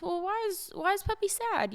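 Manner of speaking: put-on high-pitched baby-talk question; playful sympathy. Mother to daughter playing sad puppy, high playful concern.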